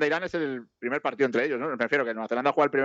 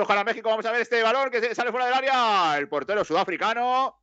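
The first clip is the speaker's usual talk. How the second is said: higher, slower stretched-out commentator call; milk the stoppage time like a TV commentator; play-by-play commentary; 7 semitones higher than usual. Acted. Mock live match commentary.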